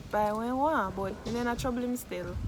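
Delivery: ordinary delivery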